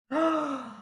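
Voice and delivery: sharp startled gasp; sudden gasp of shock. Acted. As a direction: gasp in alarm. Cake dripped all over.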